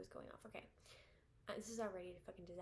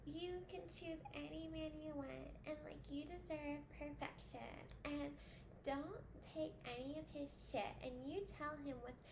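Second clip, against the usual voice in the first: mock influencer voice; 5 semitones higher than usual. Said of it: "and then they're like".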